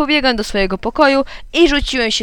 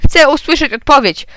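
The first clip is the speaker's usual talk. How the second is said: loud, raised impatient order; krzyk taty; 5 semitones higher, 6 dB louder than usual. A narrator's reading. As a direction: call out over the child, higher. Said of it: "krzyknął tata". (podniesiony głos zniecierpliwionego ojca)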